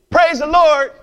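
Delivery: high, loud joyful yell of praise. Retold or imitated. Old lady yelling praise from her porch, joyful shout. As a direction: call it out from the porch, high and bright.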